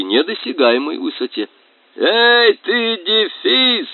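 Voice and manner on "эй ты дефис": high, slow yell. Acted. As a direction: bawl at the runaway hyphen. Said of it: "орал матрос".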